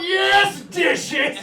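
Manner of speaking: high, loud, overjoyed cheer; ecstatic yes. Retold or imitated. Wired barista is told to do dishes and cheers high and loud. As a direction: cheer like you just won a prize. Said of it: "I was like".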